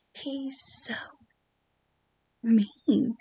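hushed, secretive whisper. Acted. Gleeful whisper about villain.

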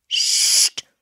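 forceful voiceless "psst"; hissed hush; sharp shushing. A narrator's reading. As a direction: shush the chatterers in front of everyone. Mourner hushing whispering women.

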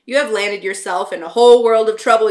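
scolding father. A narrator's reading. Nick's father scolds him in the station, stern. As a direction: lay down the law to your son. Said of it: "You know, parent talk".

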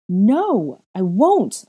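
loud high howl. A narrator's reading. Girl howling refusal at the boy, loud. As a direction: wail the refusal. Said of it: "I howled".

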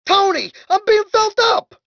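high-pitched call for help; distressed complaint. Acted. Man being groped calls to Tony, distressed. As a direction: wail to a pal in protest.

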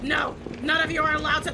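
normal talking voice

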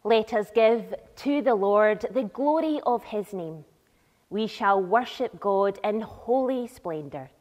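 normal talking voice

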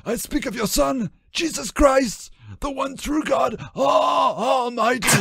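high, anguished ragged voice; ragged Judas. A narrator's reading. Judas naming the Son to the voice, high ragged cry.

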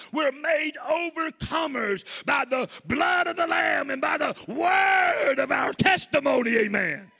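high, loud, slow shouted preaching cadence; full-force preaching. Acted. Preacher shouts to the congregation to testify, at full force. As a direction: lift the congregation with a roaring exhortation.

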